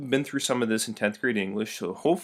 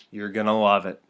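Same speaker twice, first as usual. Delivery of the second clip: normal talking voice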